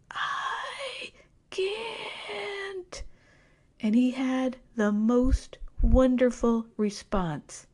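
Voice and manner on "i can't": faint whispered squeak, much higher and softer; a tiny, airless ghost of a voice; little ghost voice. Retold or imitated. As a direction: barely get the words out, too scared to breathe. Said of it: "I say in this little ghost voice".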